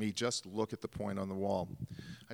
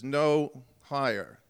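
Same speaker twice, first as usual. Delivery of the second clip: calling out; 5 semitones higher, 10 dB louder, much slower than usual. Retold or imitated. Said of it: "and Billy says".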